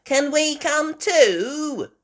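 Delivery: high, loud, slow pleading piglet; Peppa voice. A narrator's reading. Peppa asking to join the boat, high eager voice. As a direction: beg eagerly like a little girl. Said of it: "Peppa said".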